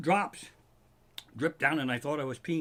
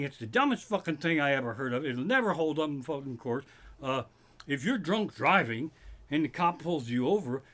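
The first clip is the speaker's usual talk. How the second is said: ordinary talk, nothing marked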